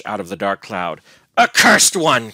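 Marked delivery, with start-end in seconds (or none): 1.4-2.3 loud, high cry of rage; enraged, scornful shout; venomous scorn and anger. A narrator's reading. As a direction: spit it out as a furious curse. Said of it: "with venomous scorn and anger".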